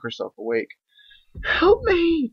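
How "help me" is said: very high, loud scream for help; shrill-pitched loud cry for help; terrified scream. Acted. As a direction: scream as the jaws come down. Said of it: "Twilight screamed".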